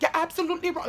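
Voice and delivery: high-pitched mock Haitian accent; Haitian teacher accent. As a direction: imitate the Haitian teacher scolding, voice jumping up. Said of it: "she had an accent".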